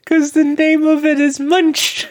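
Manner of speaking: high, slow, strained with laughter; laughing. Real, not acted. Explaining the laughing fit over a silly name, laughing. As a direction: squeal it out through helpless laughter.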